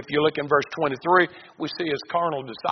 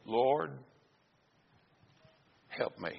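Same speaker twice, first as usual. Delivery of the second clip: soft plea; 10 dB quieter, slower than usual. Acted. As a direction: plead softly, voice dropping away. Woman's plea read softly.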